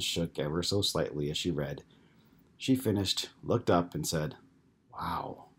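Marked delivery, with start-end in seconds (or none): 4.9-5.5 breathless, whispered wow; stunned whisper after reading the emails; barely audible whisper. Acted. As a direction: hardly voice it, just air. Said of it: "in a barely audible whisper".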